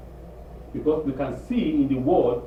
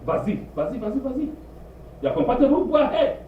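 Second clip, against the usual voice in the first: sarcastic go ahead; 10 semitones higher than usual. Retold or imitated. Mother sarcastically giving up.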